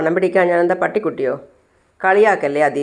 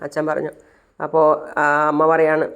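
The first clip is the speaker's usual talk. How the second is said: ordinary delivery, nothing marked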